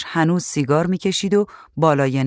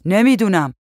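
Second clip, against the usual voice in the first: raised, slightly higher calling voice; shouted reply; 4 semitones higher, 4 dB louder than usual. A narrator's reading. Man answers wife about bug spray, mild shout. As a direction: call it back across the rooms. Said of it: "مرد داد زد".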